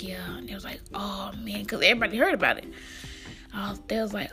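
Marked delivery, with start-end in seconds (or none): 0.0-0.8 whispered, barely there yeah; quiet sad yeah. Retold or imitated. Answering worried classmates, a weak whispered yeah. 0.9-1.6 whispered oh man; hushed sympathy. Retold or imitated. Classmates comforting her, a soft whispered oh man.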